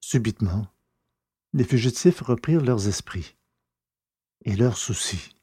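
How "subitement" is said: ordinary delivery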